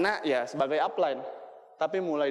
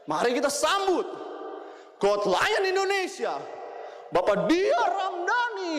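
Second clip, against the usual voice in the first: emcee shout; 12 semitones higher, slower than usual. Retold or imitated. Shouting his own award name in the bathroom, slow announcer call. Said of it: "sambil teriak".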